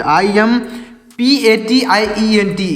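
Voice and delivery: ordinary talk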